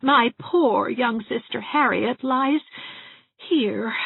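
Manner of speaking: mournful sigh over her sister's grave. Acted. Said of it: "sighed Miss Valentine".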